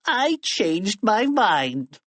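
high, drawn-out smug delivery. A narrator's reading. Melvin gloats, grinning smugly.

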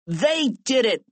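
put-on high, prim tattletale kid; Melvin Sneedly. A narrator's reading. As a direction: accuse them like a snitch. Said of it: "Melvin said, pointing".

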